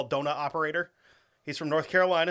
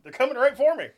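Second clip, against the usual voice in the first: higher, louder hammed-up alarm; mock panic; 10 semitones higher, 7 dB louder than usual. Joking about the lights heading at the house, in hammed-up alarm. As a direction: cry it out like a cartoon hunter in a fake panic.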